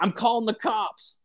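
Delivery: high-pitched mock outrage; retold angry threat. Customer threatens the fridge sellers on leaving, high mock-outraged voice. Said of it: "this dude yelling".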